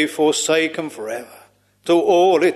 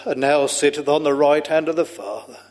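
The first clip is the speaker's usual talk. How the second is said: ordinary talk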